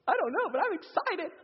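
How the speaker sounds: high-pitched hyped-up outburst; over-excited. Acted. Fired-up guy answering the sober-minded man, wildly excited. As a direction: play it comically thrilled and clueless. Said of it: "when I'm fired up".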